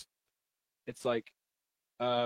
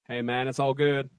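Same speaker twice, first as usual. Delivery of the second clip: ordinary delivery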